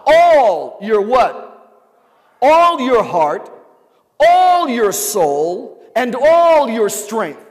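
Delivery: slow shouted sermon refrain; full-force preaching. Acted. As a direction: lead the church in a rousing chant of all your heart. Preaching with congregation response.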